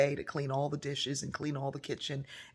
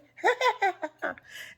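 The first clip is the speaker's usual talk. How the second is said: high, loud carefree laugh; a godmother's light laugh, much higher and louder; 8 dB louder than usual. Retold or imitated. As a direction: laugh it all off. Laugh after quote.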